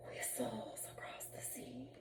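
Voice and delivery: breathy whispered echo. Acted. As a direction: whisper it like the wind. Whistling wind, echoed whisper.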